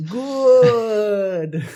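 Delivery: drawn-out high hammed evil "good"; Palpatine "good". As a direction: savour it like a gleeful Sith lord. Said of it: "it went a bit Palpatine there".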